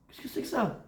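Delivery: soft, high-pitched question; mock disbelief. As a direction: ask in a small high voice.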